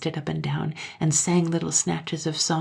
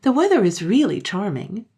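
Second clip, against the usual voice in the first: cheerful; 4 semitones higher, 5 dB louder than usual. Acted. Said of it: "same cheerful voice".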